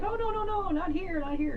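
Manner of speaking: mock alarmed protest. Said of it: "And they were like".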